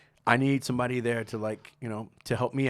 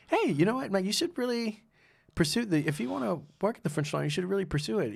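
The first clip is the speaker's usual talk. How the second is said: higher-pitched, lightly voiced friend urging him on; a hint of an eager mentor, pitched up; encouraging friend; 8 semitones higher than usual. Friend's advice retold on the beach.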